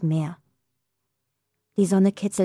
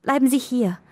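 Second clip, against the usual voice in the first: higher pleading, falling on hier; 6 semitones higher than usual. A narrator's reading. Girl begs the old woman to stay, pleading. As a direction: beg her to stay, voice straining.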